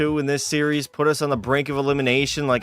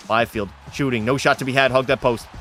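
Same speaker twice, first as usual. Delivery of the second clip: normal talking voice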